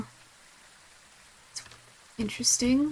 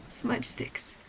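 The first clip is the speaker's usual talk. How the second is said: murmured; 7 dB quieter than usual. Real, not acted. Reading objective list quietly.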